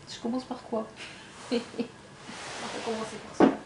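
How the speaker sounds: slow breathy laugh into the words; laughing whisper. Real, not acted. (murmure en riant)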